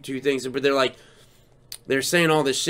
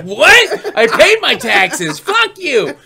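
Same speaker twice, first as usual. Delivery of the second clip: laughing retort; 10 semitones higher, 7 dB louder, much slower than usual. Retold or imitated.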